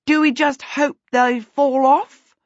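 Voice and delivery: panting. A narrator's reading. Winded pursuer asking about the two on the roof, panting. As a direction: ask it slowly, winded from the climb. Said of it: "she panted".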